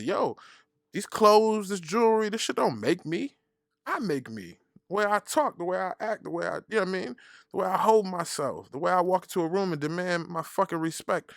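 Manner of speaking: high-pitched self-justifying rant; defensive. Retold or imitated. Host defending his flyness to her, high and defensive. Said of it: "I got defensive real quick".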